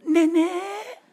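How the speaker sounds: high, sweet customer greeting; daughter's phone voice. Retold or imitated. Daughter answering a customer call, sweet and high. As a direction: chirp it for a client. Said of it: "고객한테 전화가 왔어요".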